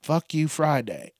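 ordinary talk, nothing marked

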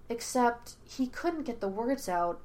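usual speaking voice

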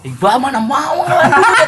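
high-pitched, laughing jibe; laughing tease. Real, not acted. Teasing a friend about spending money, laughing. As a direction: tease him through real laughter.

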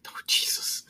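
breathy unvoiced whisper of dismay; whispered oh Jesus. Real, not acted. Friend reacts to grim story, whispering. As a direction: whisper it, half appalled.

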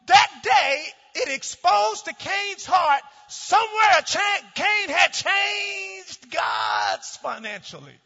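loud, high, driving sermon voice; preaching at full force. Acted. Pastor preaching to his congregation, full-force shouting. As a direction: preach it hard, rising to a shout.

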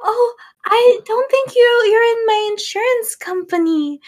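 put-on high, quavering little old lady. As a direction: read it like a sweet old lady. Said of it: "she's like the sweet little old lady".

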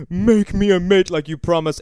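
lightly voiced desperate creature; mock monster plea. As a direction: beg the creator, hammed up. Monster pleading with Victor.

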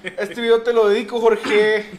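high, loud showy shout-out; playful loud proclamation; mock shouted dedication. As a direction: belt out a dedication, half laughing.

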